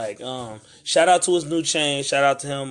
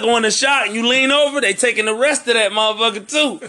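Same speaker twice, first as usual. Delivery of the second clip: excited storytelling; 7 semitones higher, 4 dB louder than usual. Retold or imitated. Excited retelling of attack.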